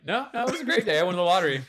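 high, loud mock Joel voice; high-pitched quote of Joel; imitating Joel. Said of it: "Joel's like".

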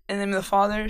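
normal talking voice

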